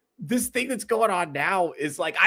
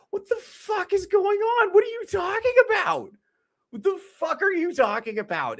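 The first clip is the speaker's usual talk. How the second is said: very high, incredulous squeal; high-pitched, stretched-out incredulity; exasperated disbelief. Real, not acted. Exasperated reply to online posts.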